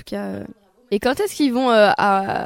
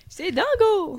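laughing; 9 semitones higher, much slower than usual. Pretend. Repeats catchphrase laughing.

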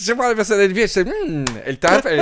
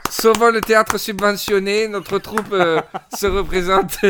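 announcer voice; 7 semitones higher, 6 dB louder than usual. Pretend. Mock theatre troupe announcement.

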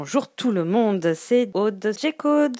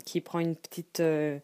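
normal talking voice

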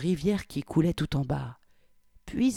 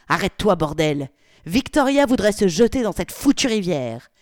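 loud angry order; 4 semitones higher, 8 dB louder than usual. Acted. Polly ordering chauffeur to stop.

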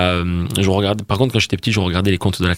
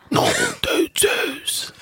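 mock Doc Brown, very high and very slow; hammed-up cry of the mad scientist; Doc impression; much slower than usual. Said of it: "tu peux nous refaire le doc". (imitation exagérée du Doc, très aiguë et lente)

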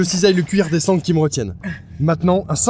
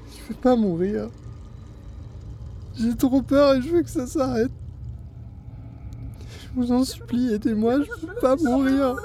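scared pleading; 7 semitones higher, 7 dB quieter than usual. Acted. Terrified captive begging for help.

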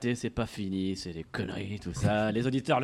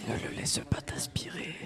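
hushed ASMR whisper; 12 dB quieter than usual. Pretend. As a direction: whisper softly and slowly. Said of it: "un peu d'A S M R".

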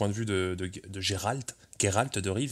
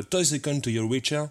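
put-on English with a French accent; super English accent; 4 semitones higher than usual. Host quotes the Witcher song title for listeners, French-accented English. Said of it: "avec mon super accent anglais".

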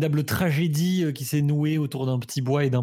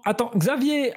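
Xavier with a German Z, higher; German accent French; 7 semitones higher than usual. Acted. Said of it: "je fais un accent allemand pour le Z".

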